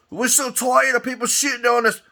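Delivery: high, put-on whining cop voice; mock whiny police. Mocking police who complain about public scorn, high whiny voice. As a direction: whine it like a self-pitying cop.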